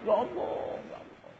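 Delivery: imitating a weepy plea, high and soft, dying away; mock tearful prayer. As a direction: wail softly, as if praying through tears. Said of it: "Sambil nangis-nangis". (menirukan doa sambil menangis)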